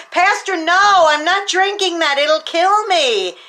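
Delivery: retold teen's high frightened plea; panicked pleading. Boy begging pastor.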